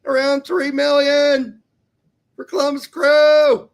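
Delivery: loud, high, punchy news read; news bulletin read. Acted. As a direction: announce it like a breaking sports ticker. Reads transfer news bulletin.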